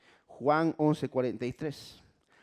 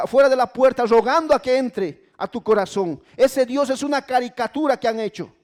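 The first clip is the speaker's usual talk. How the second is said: high, loud sermon at full force; fiery preaching; 9 semitones higher, 10 dB louder than usual. Acted. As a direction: preach it out, driving each phrase. (predicación encendida a todo volumen)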